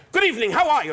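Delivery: booming, high-pitched greeting; megaphone-loud mother. Retold or imitated. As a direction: imitate mom greeting the whole room. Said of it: "like she had a megaphone".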